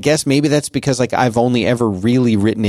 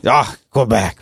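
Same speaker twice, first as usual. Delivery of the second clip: imitating a scoffing uncle; retold dismissive scoff at a place name. Uncle reacts to the Quebec trip, scoffing. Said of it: "he scoffed at it".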